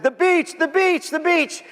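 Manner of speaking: put-on high, eager children's chant; excited kids. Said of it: "We said".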